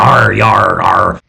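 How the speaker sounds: a hint of a West Country pirate arr; lightly voiced West Country pirate sounds; pirate growl. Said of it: "talk like a pirate".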